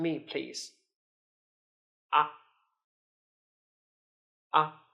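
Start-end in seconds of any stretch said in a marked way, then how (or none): 2.1-4.8 "Ah" cut short, with no glide; a brief, unstressed "Ah"; neutral tone. Shown as a demonstration. Teacher demonstrates neutral tone, short "Ah". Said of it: "It's relatively short and weak".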